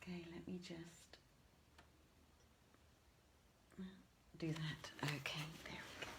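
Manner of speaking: quick whisper to herself while fixing the setup; hushed off-mic aside. Real, not acted. Host sorting her camera before the reading, whispering.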